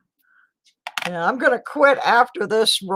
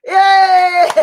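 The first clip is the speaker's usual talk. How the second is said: high, loud cheer; 9 semitones higher, 8 dB louder than usual. Real, not acted. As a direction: cheer like you just won. Cheering about fewer dislikes.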